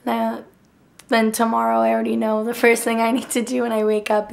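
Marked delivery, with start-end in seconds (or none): none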